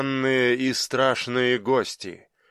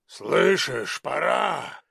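giant; much slower than usual. A narrator's reading. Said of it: "сказал гигант".

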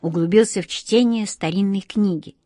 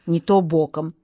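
plain reading voice

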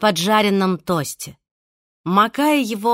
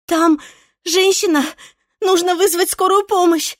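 high, loud, breathless and flustered; shaken tearful witness; 10 semitones higher, 5 dB louder than usual. Acted. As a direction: blurt it out in a panic. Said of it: "сбивчиво рассказывала взъерошенная девушка".

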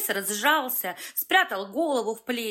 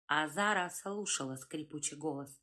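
usual speaking voice

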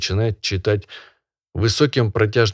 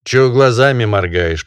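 repeated louder, stressing глазами; 5 semitones higher, 6 dB louder than usual. Acted. Barin repeating his question louder at the peasant. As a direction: press him, louder this time. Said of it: "повторяет Волчков".

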